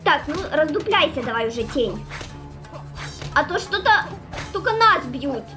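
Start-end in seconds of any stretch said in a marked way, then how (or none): none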